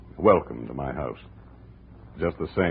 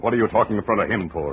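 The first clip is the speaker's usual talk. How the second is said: angry rebuke; 5 semitones higher, 6 dB louder than usual. Acted. Jake angrily rebukes Andrea.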